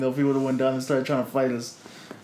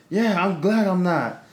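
retold retort; 6 semitones higher, slower than usual. Lady retorts in story.

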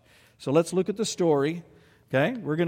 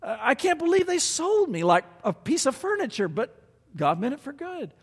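much higher, incredulous Joseph reliving his wrongs; voicing Joseph; 8 semitones higher than usual. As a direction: play Joseph still amazed at what was done to him. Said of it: "He could say at the end of every thought".

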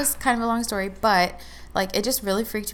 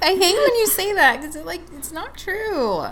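high-pitched real protest through laughter; laughing protest; 10 semitones higher, 4 dB louder than usual. Real, not acted. Friend objecting to her calling herself ugly, laughing protest.

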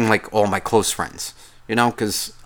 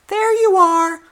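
much higher, sing-song mocking squeak; mock squeaky high voice; 5 dB louder, slower than usual. Mocking his partner's greeting in a high squeak. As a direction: parrot them in a silly high squeak.

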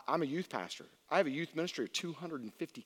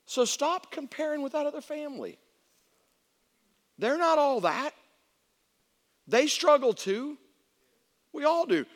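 high, loud preacher's exhortation; emphatic raised pulpit voice; forceful preaching; 11 semitones higher, 8 dB louder than usual. Acted. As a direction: drive it home to the congregation, high and loud.

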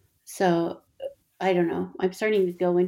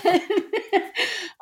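high laugh; 12 semitones higher than usual. Real, not acted. Laughing at the memory.

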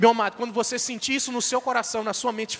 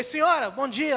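raised voice, pitched higher, calling across the yard; calling out a greeting; 7 semitones higher than usual. Retold or imitated. Pastor calls a greeting to the woman from outside. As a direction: call out to the woman at the stove. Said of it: "Aí eu gritei".

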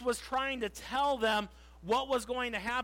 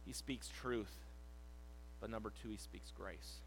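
ordinary talk, nothing marked

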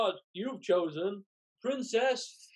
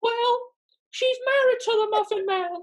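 squeaky gingerbread man; 5 dB louder than usual. Acted. Gingerbread man taunting Farquaad.

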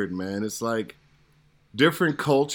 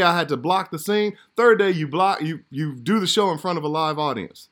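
higher, building astonishment; can't believe the money you made; amazed disbelief; 7 semitones higher, 4 dB louder than usual. Retold or imitated. Actor telling hosts about his first TV check, amazed. Retelling shock at the paycheck.

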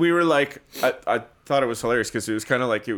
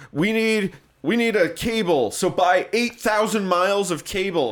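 pitched up and loud, drawn-out bureaucrat order; mock boss voice; 7 semitones higher, 4 dB louder, slower than usual. Coworker mocking eighties bosses, slowed and loud. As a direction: lampoon a careless manager, raised and loud.

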